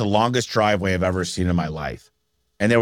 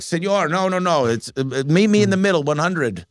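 Mexican vendor; 8 semitones higher than usual. Retold or imitated. Vendor haggling with dad over a leather jacket, mock Mexican accent. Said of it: "they're like".

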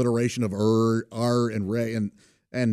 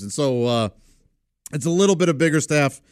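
ordinary talk, nothing marked

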